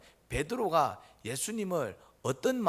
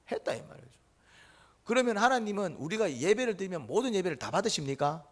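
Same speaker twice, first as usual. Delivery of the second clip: ordinary talk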